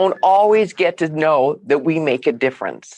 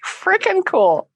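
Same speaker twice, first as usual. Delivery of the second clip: overjoyed; 12 semitones higher than usual. Real, not acted. Repeating joyful exclamation.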